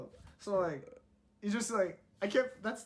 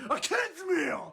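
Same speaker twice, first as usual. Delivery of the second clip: very slow, much higher, louder mock yell; mock shout; 12 semitones higher, 5 dB louder, much slower than usual. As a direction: stretch the order out in a fake yell. S1 imitates the yelling customer.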